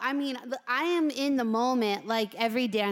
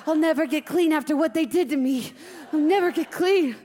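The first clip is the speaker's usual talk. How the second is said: repeated wail climbing higher and louder; hammed-up traumatised breakdown; 6 semitones higher, 6 dB louder than usual. Hamster reliving show and tell, mounting panic. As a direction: spiral into comic hysteria.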